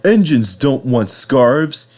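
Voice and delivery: a hint of a slow, haughty big engine; Henry, dismissive. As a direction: slowly put the small engine in its place. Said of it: "said Henry".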